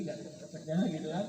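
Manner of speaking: ordinary delivery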